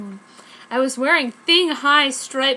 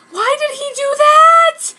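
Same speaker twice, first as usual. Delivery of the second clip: high, slow wail of a question; mock-sad squeal; 11 dB louder than usual. Sakura asking her friend, in a hammed-up sad squeal. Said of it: "I said sadly".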